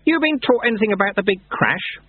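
high-pitched, open question. A narrator's reading. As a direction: lift the voice out of confidential tones. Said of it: "Lutze raised his voice again".

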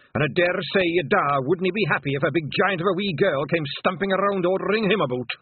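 high, loud, affronted brogue; indignant Scottish elder. Acted. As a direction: scold the girl as a proud old Scot.

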